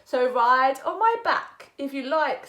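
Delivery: dog offering to carry the frog; friendly dog offer. Acted. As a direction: offer your back cheerfully. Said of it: "said the dog".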